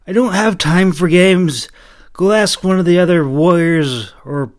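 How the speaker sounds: impatient knight. A narrator's reading. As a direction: wave the kid away. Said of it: "he suggested".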